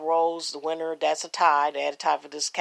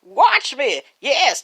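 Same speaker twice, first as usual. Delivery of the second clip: excited; 6 semitones higher, 7 dB louder, much slower than usual. Real, not acted. Cheering the show.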